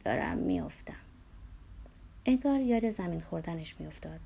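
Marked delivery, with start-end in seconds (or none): 0.0-0.9 low, creaky moan; moaned cry of a patient. A narrator's reading. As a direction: groan it out in a sunken voice.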